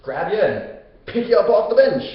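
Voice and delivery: high, cocky can-do hero; Hercules. Retold or imitated. Hercules offers to free his stuck friend, cocky. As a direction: offer the rescue breezily, pitched well up. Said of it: "Hercules is like".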